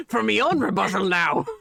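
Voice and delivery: hammed-up pirate voice; pirate accent. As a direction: growl it like a pirate. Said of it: "went full pirate there".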